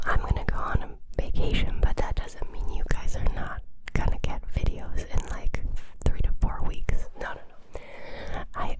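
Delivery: usual speaking voice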